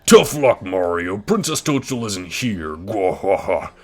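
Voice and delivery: loud, booming villain taunt; Bowser voice. Acted. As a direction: roar it like a gloating king. Reading Bowser's taunt aloud.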